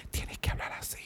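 unvoiced hushed instruction; whisper. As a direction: whisper it like a backstage order. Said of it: "le dieron la instrucción de".

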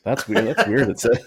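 ordinary delivery

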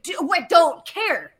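mock exasperated wait; impatient. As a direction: cut him off, bored of his stuff. Impatient for the minerals.

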